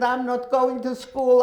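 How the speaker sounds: loud, high protest; upset refusal. Retold or imitated. Teenage girl protesting to her sister, loud and upset. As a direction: cry out against going, near tears.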